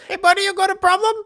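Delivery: high, loud challenge from a cabbie; tough cab driver. Retold or imitated. Cab driver leaning out to confront Steve, high mocking challenge. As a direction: pick a fight out the window. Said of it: "Driver leans his head out".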